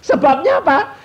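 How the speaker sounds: high, loud rhetorical question; booming preacher's question. Real, not acted. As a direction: fire the question at the room. Preacher asks congregation loudly.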